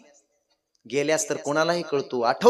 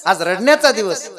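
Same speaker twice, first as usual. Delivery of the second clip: raised, high-pitched disbelief at her son; mother's acted reproach; 8 semitones higher, 5 dB louder than usual. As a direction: play a mother loudly questioning her weeping son. Mother chides crying king, acted.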